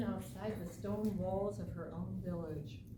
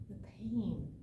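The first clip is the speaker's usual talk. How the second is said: whispered. A narrator's reading. Hushed climax of story.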